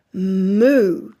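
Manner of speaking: loudish imitation cow moo; animal noise. Shown as a demonstration. Parent pointing to a cow in a book, animal noise. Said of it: "using animal noises".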